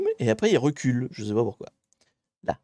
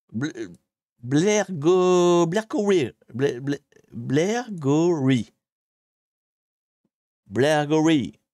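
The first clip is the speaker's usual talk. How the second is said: attempted Scottish accent; 3 semitones higher, much slower than usual. Shown as a demonstration. Streamer trying a Scottish town's name for viewers, attempted Scottish accent. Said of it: "Prononcé avec un accent écossais".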